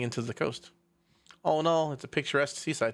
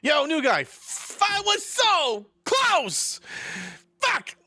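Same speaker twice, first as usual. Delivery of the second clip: high, drawn-out yell of frustration; real frustrated outburst; 12 semitones higher, 3 dB louder, much slower than usual. Real, not acted. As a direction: yell it high, stretching the words out. Player reacts in frustration at game.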